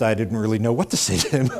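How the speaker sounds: usual speaking voice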